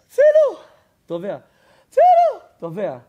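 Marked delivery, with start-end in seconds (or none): 0.1-0.6 very high, loud scream of "help"; cry for help. Acted. Drowning child in the pool screaming for help. 1.9-2.4 very high, loud scream of "help"; cry for help. Acted. Drowning child in the pool screaming for help.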